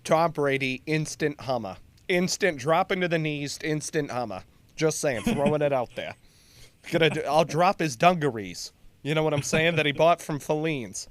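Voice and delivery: hammed-up Boston local; Bostonian accent. Boston fan riffing on Tom Brady, in a Bostonian accent. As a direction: riff as a Boston sports fan. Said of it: "in a Bostonian accent".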